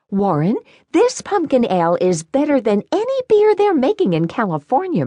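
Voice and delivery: high, bright-eyed former singer voice; sing-songy praise in a high voice; Marie voice. Acted.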